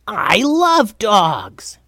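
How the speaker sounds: high, stretched-out goblin excitement; thrilled Goblin King. A narrator's reading. Steve noticing the dog, thrilled high goblin. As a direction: squeal it slowly as a delighted goblin.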